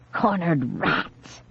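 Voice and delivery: slow, low, soft grinning sneer. A narrator's reading. Girl sneering at her cornered victims, slow and grinning. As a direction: drag out the taunt with a grin. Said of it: "she said, grinning".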